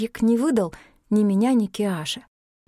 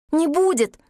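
emphatic high-pitched exclamation; 8 semitones higher, 3 dB louder than usual. A narrator's reading. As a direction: blurt out the promise, pitched high. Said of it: "одновременно воскликнули". (горячее восклицание)

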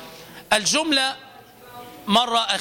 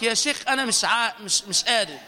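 imitating a struggling caller; faster than usual. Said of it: "تجد مؤذنا يقول لك".

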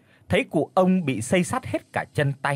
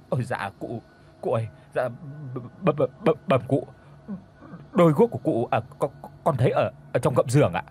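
frightened stammer; faster than usual. A narrator's reading. Frightened boy stammers to master.

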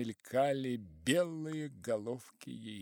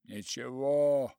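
heiser; 2 semitones higher, 8 dB louder, slower than usual. A narrator's reading. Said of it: "хрипел уездный воин".

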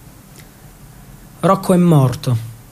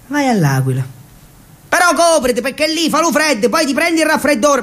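higher mother's voice, worried and resigned; higher, fretting dialect mother; fussing mother; 5 semitones higher than usual. Acted. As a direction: give in, then fuss over his health. Mother gives in, warns son.

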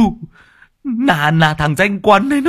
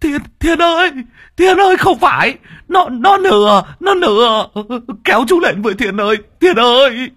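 panicked screams; 5 dB louder than usual. Acted. Dragged into river, screaming.

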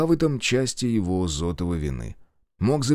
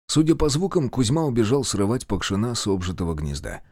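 usual speaking voice

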